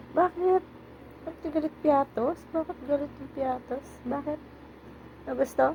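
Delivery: high-pitched coaxing questions to a pet; pet-talk voice. Real, not acted. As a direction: ask the pet in a high little voice. Cooing questions to upset pet. (mataas na boses na panlambing sa alaga)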